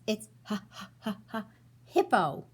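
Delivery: laughs then blurts it loud; laughing. Acted. Rhino finally admits his name, laughing.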